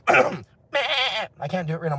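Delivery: slow, strained hoarse voice. Real, not acted. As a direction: rasp through a tired throat. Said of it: "I'm losing my voice".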